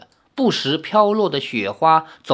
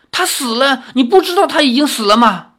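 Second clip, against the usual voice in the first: high, loud and fast tearful cry; sudden tearful outburst; 9 semitones higher, 5 dB louder, faster than usual. A narrator's reading. Girl forces the grieving hero to hear the truth, tearful outburst. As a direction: blurt it out through tears. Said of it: "突然大声说".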